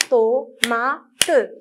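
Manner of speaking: "Tomate" split as to, ma, te; "Tomate" with the final te sounded as its own syllable. Shown as a demonstration. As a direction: count out "Tomate" in three separate beats. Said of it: "Combien de syllabes?".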